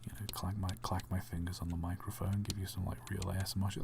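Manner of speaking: low, hushed and close to the mic; soft, low ASMR murmur; gentle ASMR voice. Shown as a demonstration. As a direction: talk real gentle, leaning into the microphone. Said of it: "I can talk real gentle".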